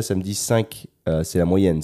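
ordinary delivery

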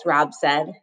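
plain reading voice

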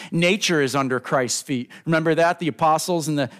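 normal talking voice

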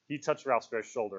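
ordinary delivery, nothing marked

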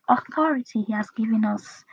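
normal talking voice

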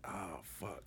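very soft, under the breath; hushed curse. Retold or imitated. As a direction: curse quietly so no one hears. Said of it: "and I'm like".

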